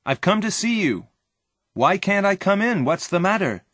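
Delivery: high, questioning call through a door; friend calling out. A narrator's reading. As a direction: call out, puzzled at being shut out. Friend calls from outside the door.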